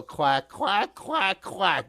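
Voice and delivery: quacking like a duck. Shown as a demonstration. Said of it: "That is your part".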